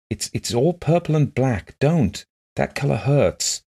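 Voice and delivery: high, halting plea, slowing at the end; distressed. A narrator's reading. Blind woman begging visitor to stop, distressed. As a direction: plead as if the sight hurts.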